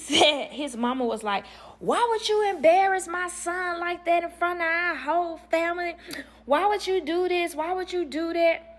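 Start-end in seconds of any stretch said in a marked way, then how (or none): 1.8-8.7 high-pitched imitation of a scolding mother; hammed-up, indignant high-pitched reproach; outraged mother. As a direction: wail it in mock outrage. Retold mother scolding her daughter-in-law.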